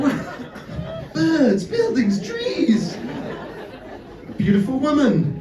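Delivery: high, amazed listing; mock wonder. Cyclist stepping out of the Matrix, acted wonder. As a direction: discover each sight as if brand new. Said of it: "It's like stepping out of the Matrix".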